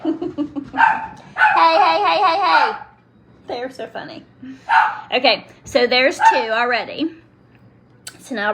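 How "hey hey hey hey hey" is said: high, louder playful hey with a laugh; laughing calls. Real, not acted. To the funny animals playing nearby, laughing calls. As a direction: call out, giggling at them.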